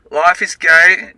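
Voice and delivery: slow, loud sing-song line. Acted. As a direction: draw it out loudly like a motto. Sung-out motto mid vlog.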